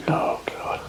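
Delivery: real whispered groan of regret; very soft, voiceless lament; whispered dismay. Real, not acted. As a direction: whisper it to yourself, suffering the aftertaste. Struggling with the beer's lingering taste.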